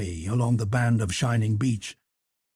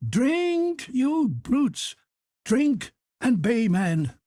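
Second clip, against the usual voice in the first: high, drawn-out rowdy command; crying out; 9 semitones higher, much slower than usual. A narrator's reading. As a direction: urge them on in a high cry. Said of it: "cried Montgomery".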